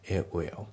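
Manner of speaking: "A will" as it will without the T, i-wil; dropped T. Shown as a demonstration. Teacher showing learners it will with a dropped T. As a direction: glide from the vowel of it into "will". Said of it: "イットのTが抜けますね".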